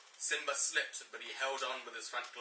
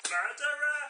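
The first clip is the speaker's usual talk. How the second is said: high, loud mock scream; hammed-up accusing shriek; cartoon yell of murderer; 13 semitones higher, 8 dB louder than usual. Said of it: "Simba screamed".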